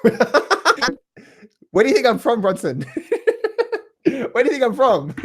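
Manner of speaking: asking while laughing, high and slow; giggling tease about where he's from. Real, not acted. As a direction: laugh through the question. Laughing at the viewer's guess.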